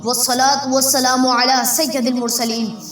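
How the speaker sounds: ordinary talk, nothing marked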